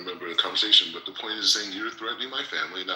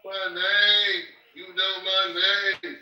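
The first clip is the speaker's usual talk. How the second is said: slow, higher, drawn-out taunt; 10 semitones higher, 5 dB louder, much slower than usual. Real, not acted. Taunting the host who mocked his name, slow and higher. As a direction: lean on each word to needle him.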